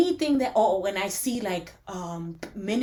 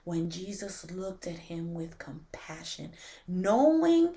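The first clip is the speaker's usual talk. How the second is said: tender scripture reading; 4 semitones lower, 6 dB quieter than usual. Real, not acted.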